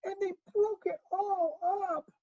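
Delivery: tiny, very high cub wail; squeaky upset Baby Bear; wee small Baby Bear. Acted. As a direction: whimper it high, like a little cub. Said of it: "cried the Baby Bear in his wee".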